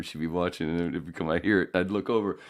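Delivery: normal talking voice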